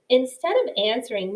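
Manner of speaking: plain reading voice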